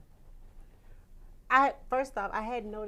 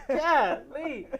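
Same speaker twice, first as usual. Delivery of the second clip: loud, laughing golly; laughing exclamation; 5 semitones higher, 13 dB louder than usual. Retold or imitated. Singer mocking her own runs, laughing.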